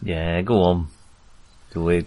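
mock tempter, pitched well down; low peer-pressure goading. Player urging a friend to take the laudanum, low mock goading. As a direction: drop low and egg them on.

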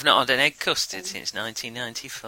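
ordinary delivery

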